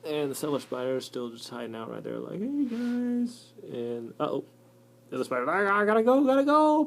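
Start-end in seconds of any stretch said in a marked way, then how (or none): 2.4-3.5 meek cellar spider. Acted. 5.5-6.9 much higher, loud frantic squeal; squealing mock panic; panicked spider. Spider fleeing.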